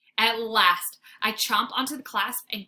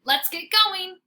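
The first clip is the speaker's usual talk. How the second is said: high, loud eager call; dog eager to go in; 9 semitones higher, 8 dB louder, slower than usual. Acted. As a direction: rush them along.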